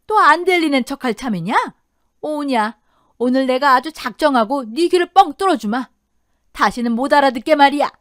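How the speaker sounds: loud bullying threat; angry threat. Acted. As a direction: threaten her at full voice. Mother-in-law threatening her daughter-in-law.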